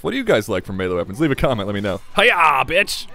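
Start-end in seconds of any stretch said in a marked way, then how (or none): 2.2-3.1 playful loud high karate yell; battle cry. Pretend. Player yelling playfully as he swings a melee weapon, battle cry. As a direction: shout it like a mock ninja strike.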